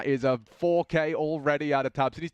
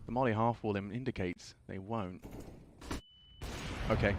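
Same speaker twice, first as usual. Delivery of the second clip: normal talking voice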